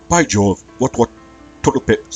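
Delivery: loud, high mock Rockingham accent; hammed-up Rockingham exclamations. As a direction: tease with an overdone Rockingham accent. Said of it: "that Rockingham accent was a decent way".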